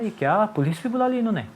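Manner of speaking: high, startled disbelief; shocked surprise. Retold or imitated. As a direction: gasp it out, caught off guard. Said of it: "मेरे को बड़ा आश्चर्य हुआ". (ऊँची आवाज़ में चौंककर हैरानी)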